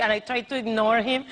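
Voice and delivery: ordinary talk, nothing marked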